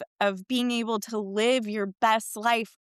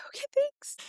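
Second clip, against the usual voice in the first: high-pitched nervous squeak; nervous okay; 3 dB quieter than usual. Retold or imitated. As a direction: squeak out thanks, scared stiff behind the wheel. Nervous teen answering dad.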